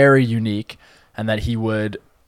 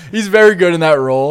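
normal talking voice

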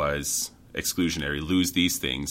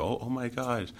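patronizing praise; 5 semitones higher than usual. Retold or imitated. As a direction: imitate someone condescendingly impressed. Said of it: "a point of almost patronizing".

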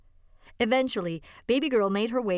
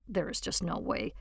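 much softer, lower disbelieving mumble; mumbled to himself; 4 semitones lower, 7 dB quieter than usual. Acted. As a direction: mutter it under your breath in disbelief. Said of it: "he mumbled to himself".

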